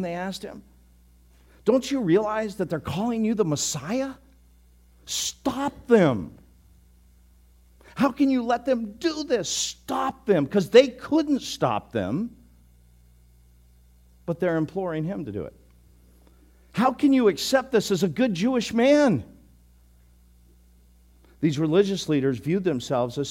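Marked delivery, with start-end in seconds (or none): 1.6-10.5 higher, pressing indignant demand; lightly voiced outraged priests; indignant leaders. Religious leaders demanding Jesus stop the children, indignant. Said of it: "they say back to Jesus". 16.7-19.2 higher, scandalized challenge; a touch of outraged priest; indignant rebuke. Acted. Religious leaders scolding Jesus, mildly indignant.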